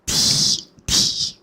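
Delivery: breathy "Tea, tea" blown right into the mic; mic-blowing tea. Shown as a demonstration. Testing ASMR triggers, breath blown into the mic. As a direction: blow the word straight into the microphone. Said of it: "That was just me blowing into the mic".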